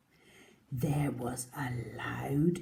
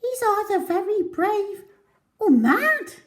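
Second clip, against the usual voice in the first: high, quick monkey chatter; 9 dB louder than usual. Acted. Monkey gasps at the brave mouse, high chatter. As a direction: squeak it out like an excited monkey. Animal onlooker voice.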